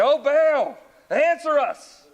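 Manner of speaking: high, pleading call to an absent god; desperate cry to Baal. Acted. Prophets of Baal calling out.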